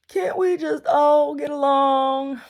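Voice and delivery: high, drawn-out shout; hammed-up pleading cry, stretched long and loud; loud mock plea. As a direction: wail it slowly at the top of your voice. Mock plea quoting Rodney King.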